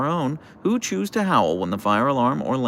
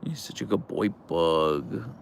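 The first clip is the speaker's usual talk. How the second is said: soft cooing; 4 semitones lower, 4 dB quieter, slower than usual. Real, not acted. Cooing at the dog.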